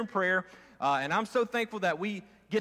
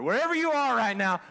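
ordinary talk

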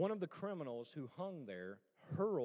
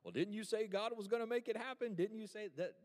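mocking taunt; 6 semitones higher, 5 dB louder than usual. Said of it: "everybody else ... just be like".

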